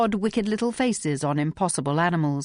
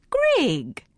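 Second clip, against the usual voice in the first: shocked exclamation; 11 semitones higher than usual. A narrator's reading. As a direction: exclaim in reproach. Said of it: "exclaimed Miss Gallagher".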